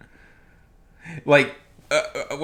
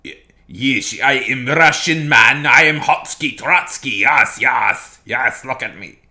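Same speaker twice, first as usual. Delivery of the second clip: louder, slow, goofy fake Russian accent; 7 dB louder, slower than usual. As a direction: parody a bad actor's Russian accent. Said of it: "bad fake Russian accents".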